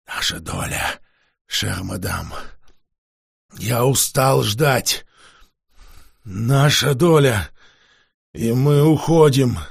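slow, weary, insistent demand; drained vampire losing patience; tired vampire demanding. Acted.

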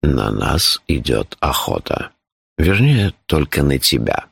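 low, composed announcement; deep, unruffled, matter-of-fact voice; imperturbable. A narrator's reading. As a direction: break alarming news without any alarm. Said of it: "невозмутимо сообщил он".

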